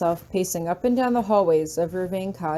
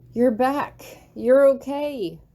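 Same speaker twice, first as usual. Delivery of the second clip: relieved joy; 5 semitones higher, 4 dB louder, slower than usual. A narrator's reading. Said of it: "smiling widely at him".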